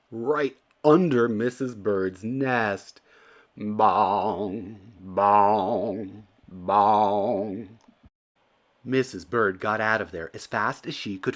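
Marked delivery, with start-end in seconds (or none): 3.6-7.8 loud ringing bell bongs. A narrator's reading. Narrator imitating the church bell, loud bongs. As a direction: ring each bong like a big bell.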